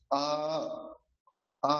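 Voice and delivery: আ drawn out, held over a second; long Sanskrit আ; long vowel demo. Shown as a demonstration. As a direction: stretch the আ long. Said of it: "এটাকেই যদি আমরা দীর্ঘ উচ্চারণ করি".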